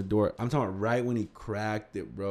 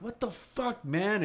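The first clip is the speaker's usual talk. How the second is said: angry high retort; 10 semitones higher, 3 dB quieter, slower than usual. Retold or imitated. Jesse hitting prankster cousin.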